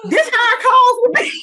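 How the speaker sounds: high, loud laugh bursting out; cracking up mid-sentence. Real, not acted. Laughing about their calls.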